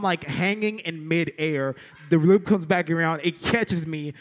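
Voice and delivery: ordinary talk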